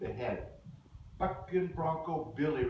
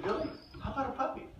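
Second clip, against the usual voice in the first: parent coaxing; 10 semitones higher, much faster than usual. Retold or imitated. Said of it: "turned to Billy and said".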